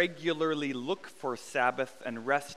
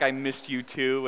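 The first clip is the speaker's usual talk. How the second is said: ordinary talk, nothing marked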